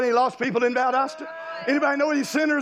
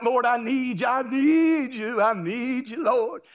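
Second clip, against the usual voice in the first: whiny pleading to the Lord, slowed; mock-plaintive begging prayer; mocking crying-out song; slower than usual. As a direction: mimic a needy praise chorus. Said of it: "them kind of crying out songs".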